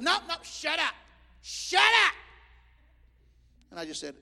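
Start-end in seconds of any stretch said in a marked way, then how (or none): 0.0-2.1 high, loud commanding shout; preacher silencing a demon, shouting. Retold or imitated. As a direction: bark the order at the devil.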